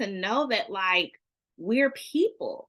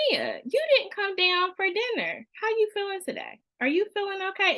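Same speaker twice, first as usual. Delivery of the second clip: higher, gently caring check-in; mother noticing her child skipped dinner; concerned parent; 10 semitones higher than usual. Pretend.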